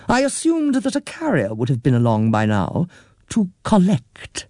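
a lightly voiced stiff official; polite manager. A narrator's reading. Manager explains.

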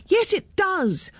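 put-on high, drawn-out children's comeback; called retort. A narrator's reading. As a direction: call back high, like defiant kids. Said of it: "called the children".

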